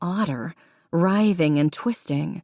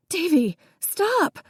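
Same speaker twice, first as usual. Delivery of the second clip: much higher, drawn-out cry; high plea; 12 semitones higher, slower than usual. A narrator's reading. Jessica crying out to her brother, high plea. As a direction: cry out to stop him. Jessica pleading over the fight.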